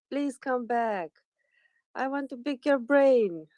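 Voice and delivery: hammed-up plaintive plea, pitched high; playful pleading. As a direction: beg playfully for them to return. Playfully begging lost guest to return.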